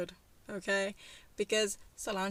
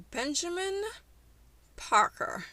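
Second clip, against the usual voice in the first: high-pitched drawn first name, then loud surname; full-name scolding, each name set apart; stern warning; 9 semitones higher, 3 dB louder than usual. Retold or imitated. Fiancée warns her man to speak up or she leaves, stern. Exasperated fiancée's ultimatum in retold quarrel.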